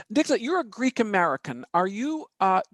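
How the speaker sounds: ordinary talk, nothing marked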